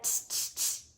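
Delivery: whispered tutting back; tsk-tsk hiss. A narrator's reading. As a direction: tut at him like a cheeky monkey.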